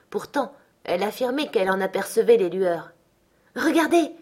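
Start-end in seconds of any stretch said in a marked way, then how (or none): 3.6-4.1 higher, sharp call to look; a delirious woman crying out at the window; eager cry. Acted. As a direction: point it out with sudden eagerness. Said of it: "s'écria-t-elle vivement".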